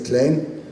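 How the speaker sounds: "cleen" as one syllable with a drawn-out e; long vowel. Shown as a demonstration. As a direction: stretch the e of "cleen" without breaking it. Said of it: "en lugar de decir".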